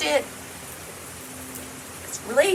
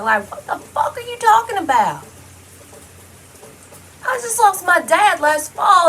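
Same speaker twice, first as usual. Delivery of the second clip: real outrage; 7 semitones higher than usual. Real, not acted. Venting to viewers about relatives circling the farm, furious high outburst. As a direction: let the fury spill out, pitched way up.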